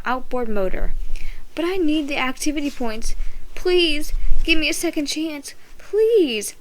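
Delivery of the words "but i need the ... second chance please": higher, pleading voice rising on please; begging. A narrator's reading. Ricky begs Tasha for second chance, pleading. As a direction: beg for one more chance. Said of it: "I begged".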